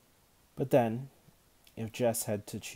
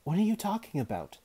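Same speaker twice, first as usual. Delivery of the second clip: higher, louder angry demand; 10 semitones higher, 6 dB louder than usual. A narrator's reading. Girl confronting Jessamine, demanding loudly. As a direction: challenge her sharply. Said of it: "Linda demanded".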